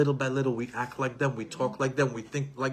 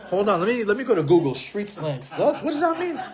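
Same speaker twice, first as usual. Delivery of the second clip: bewildered father; 6 semitones higher than usual. Retold or imitated. Father baffled by son's word sus, high and laughing. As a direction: act out a baffled dad reaching for Google. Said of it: "I'm like".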